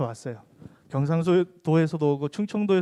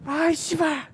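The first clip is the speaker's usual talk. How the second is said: much higher retold cry; outburst repeated; 10 semitones higher, slower than usual. Preacher repeating the president's cry to the youth, high mock yell. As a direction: fling it out again, a caricature. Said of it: "이렇게 얘기를 하는 거예요".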